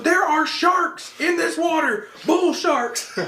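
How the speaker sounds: high, slow mock panic about sharks; dad warning of sharks, hammed-up high panic; freaking out. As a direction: drag it out high like a scared man. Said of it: "He was freaking out".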